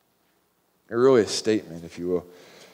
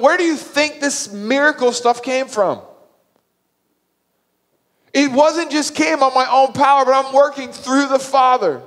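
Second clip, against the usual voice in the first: high, loud, incredulous scolding; impassioned, frustrated outburst; exasperated rebuke; 12 semitones higher, 5 dB louder than usual. Retold or imitated. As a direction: drive it home to a dense follower. Jesus exasperated with Philip, acted.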